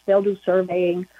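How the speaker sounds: usual speaking voice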